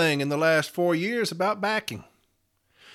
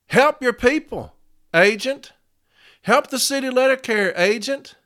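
raised, impassioned plea to the union; heated rant; 7 semitones higher, 4 dB louder than usual. Real, not acted. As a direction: demand they act, voice raised high.

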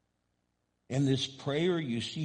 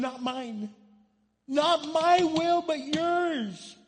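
much higher, louder desperate cry; anguished pleading; 13 semitones higher, 5 dB louder than usual. Acted. Preacher voicing Jesus begging, then louder surrender.

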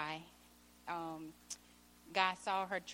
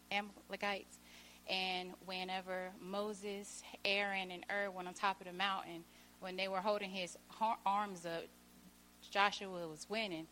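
ordinary talk, nothing marked

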